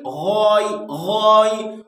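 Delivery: "गै" with a thick, throaty gh; heavy ghain. Shown as a demonstration. Teacher showing a heavy letter, "गै" thickened. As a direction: make the gh of "गै" full-mouthed and rounded. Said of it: "गैन भी मोटे हुरूफ में है".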